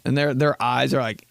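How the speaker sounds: ordinary talk, nothing marked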